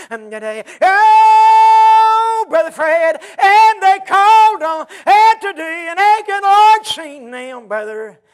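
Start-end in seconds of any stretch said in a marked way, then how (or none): 0.8-7.7 sung, high, loud and slow preacher's whoop; full-force musical whoop over the congregation; whooped preaching. Acted. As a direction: lift it into the chanted climb of a hacking preacher.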